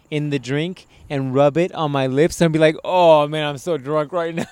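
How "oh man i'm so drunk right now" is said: hammed-up tipsy voice, louder; fake drunk. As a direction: play it like a lightweight pretending to be wasted. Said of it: "I'm gonna, I'm gonna".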